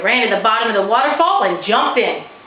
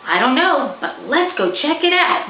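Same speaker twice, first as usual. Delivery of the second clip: put-on young boy voice; 7 semitones higher than usual. Boy character urges sister.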